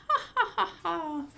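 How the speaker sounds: high playful ha-ha-ha laugh; little dog laughing. Acted. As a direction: laugh like a giggling puppy. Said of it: "The little dog laughed".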